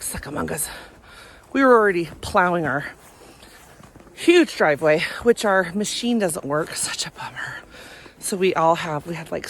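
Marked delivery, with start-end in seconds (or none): 0.0-0.7 whispered aside. Real, not acted. Muttered aside about school email. 6.7-7.6 whispered aside. Real, not acted. Muttered complaint about the snowblower.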